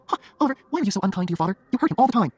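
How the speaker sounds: high, insistent female character voice; reproachful girlfriend. Acted. Jenny reproaches Oliver about father.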